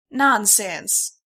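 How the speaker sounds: loud, hearty dismissal in a twang; the stallion refuses to hear her excuses; firm nonsense. A narrator's reading. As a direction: bluster it down. Stallion insists.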